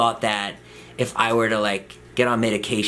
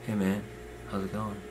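imitated withdrawn friend; 2 semitones lower, 12 dB quieter than usual. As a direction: barely get the greeting out, softly. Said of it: "Like that".

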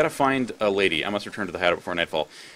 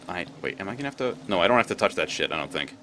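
usual speaking voice

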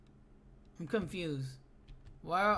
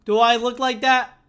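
louder and higher, rising indignation; building outrage; 3 semitones higher, 10 dB louder than usual. Real, not acted. Gamer protesting to friend that he looks like the picture, building yell. Offended by the image.

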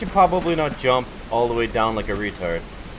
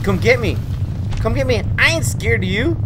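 taunting; 6 semitones higher than usual. Real, not acted. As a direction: taunt the monster. Taunting the monster.

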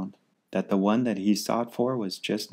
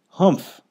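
high, loud scornful humph; 9 semitones higher, 9 dB louder than usual. Acted. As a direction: snort it out in disdain. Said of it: "humphed the voice".

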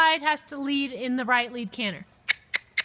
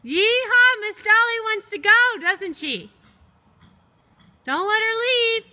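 excited urging; 6 semitones higher than usual. Real, not acted. Instructor urging horse and rider.

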